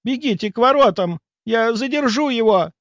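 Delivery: high, urgent shouted order; выкрик. A narrator's reading. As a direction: cry out orders in an emergency. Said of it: "выкрикнула она". (тревожный крик, высоко)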